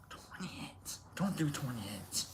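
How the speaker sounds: very soft, near-whispered aside; barely audible cautionary murmur; hushed warning. Real, not acted. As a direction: drop to a whisper, as if warning yourself. Muttered warning after the story.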